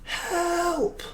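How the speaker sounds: high, drawn-out cry at ordinary volume; mock scream for help. Player shouts out for help in the game, mock scream. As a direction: call for help in a held-back, high-pitched wail. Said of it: "you scream at the top of your lungs".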